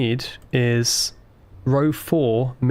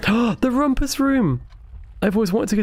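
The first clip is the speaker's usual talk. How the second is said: excited; 9 semitones higher than usual. Real, not acted. Excited discovery of room.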